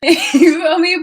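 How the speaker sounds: loud laugh into the question. Real, not acted. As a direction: cackle then ask it. Laughing after joke.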